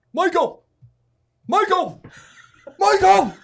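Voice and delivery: very loud high-pitched yell, name drawn out to carry; calling out. Retold or imitated. Friend searching for him at night on the dune, calling out. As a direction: holler across the dunes for a lost friend.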